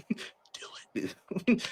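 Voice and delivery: a soft whispered urge. Retold or imitated. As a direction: breathe it like a tempting devil. Villain egging on secretly.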